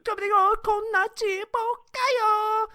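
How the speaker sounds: a high, drawn-out mock Japanese girl; mock Japanese woman. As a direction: coo it like a cutesy Japanese woman. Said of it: "all the women are just like".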